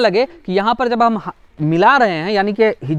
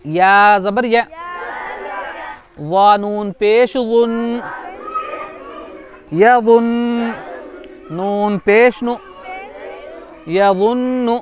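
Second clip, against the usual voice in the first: spelling drill; slower than usual. Shown as a demonstration. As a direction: chant each letter slowly for the class. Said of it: "खींच के पढ़ना".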